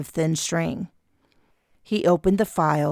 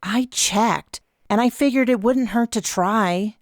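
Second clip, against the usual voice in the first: put-on whiny teenager; 6 semitones higher than usual. A narrator's reading. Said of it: "sounded more like a whiny teenager".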